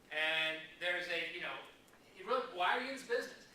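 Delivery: ordinary talk, nothing marked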